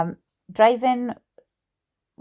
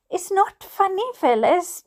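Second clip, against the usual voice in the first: high, mildly scolding; chiding; 8 semitones higher than usual. Acted. Mother to Phyllis, chiding. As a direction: tell her off, pitched up. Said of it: "chided my mother".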